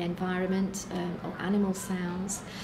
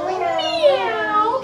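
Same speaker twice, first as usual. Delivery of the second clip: high, loud meow; loud cat meow; 13 semitones higher, 11 dB louder than usual. Shown as a demonstration. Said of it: "Let's do it like this".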